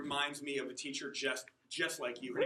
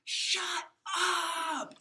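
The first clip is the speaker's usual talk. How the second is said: shrill high scream at the top of her lungs; furious teacher screaming at the class; 14 semitones higher, 5 dB louder than usual. Retold or imitated. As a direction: scream it like a teacher who has snapped. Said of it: "she just screams at the top of her lungs".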